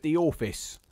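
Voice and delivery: "office" said as "orfice"; phonetic dialect pronunciation. Shown as a demonstration. Demonstrating the author's spelling, "office" pronounced "orfice". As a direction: stretch the first vowel of "office" to "or". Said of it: "the word office is spelled O R F I C E".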